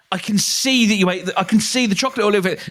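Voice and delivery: high, fast scolding parent voice; a parent catching a fibbing child. Retold or imitated. Parent confronting chocolate-faced kid.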